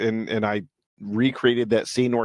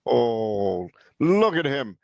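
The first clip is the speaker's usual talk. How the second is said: ordinary talk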